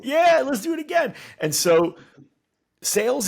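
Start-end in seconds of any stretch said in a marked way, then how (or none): 0.0-1.1 very high, loud thrilled cheer; exhilarated. Acted. First-time rider wanting more, exhilarated. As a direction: beg eagerly for another ride. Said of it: "what do they typically say?".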